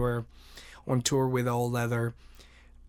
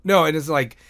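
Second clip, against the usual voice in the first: normal talking voice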